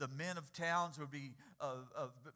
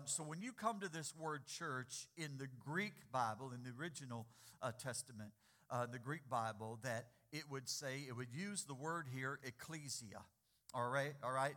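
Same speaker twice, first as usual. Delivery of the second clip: ordinary talk, nothing marked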